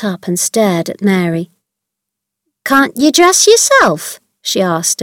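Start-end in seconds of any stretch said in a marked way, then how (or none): 2.6-4.2 slow, high disbelieving question; can't believe what she hears; amazed. A narrator's reading. Martha hearing Mary can't dress herself, amazed.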